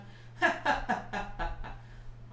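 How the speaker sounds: unvoiced panting laugh; breathy laugh. Shown as a demonstration. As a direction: laugh from the diaphragm. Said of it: "Let's laugh".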